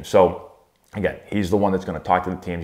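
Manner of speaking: normal talking voice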